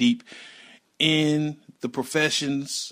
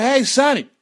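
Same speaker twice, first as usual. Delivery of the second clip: put-on high, loud holler to a kid; raised-voice mock yell; retold calling out; 12 semitones higher, 9 dB louder than usual. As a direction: call the boy out from across the yard. Retells catching and calling the boy.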